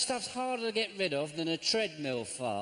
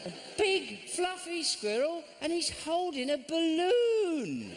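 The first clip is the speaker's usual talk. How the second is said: mock cutesy voice; 14 semitones higher, slower than usual. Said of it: "mocking the T-shirt".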